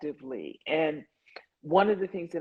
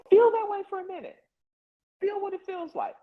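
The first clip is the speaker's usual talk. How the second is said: high, insistent coaching push; urging; faster than usual. Real, not acted. Coach urging listeners, voice jumping high. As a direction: lift the voice up high to urge them.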